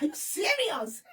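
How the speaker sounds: high, slow are you serious; disbelief. Retold or imitated. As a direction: act out stunned disbelief. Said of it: "I was like".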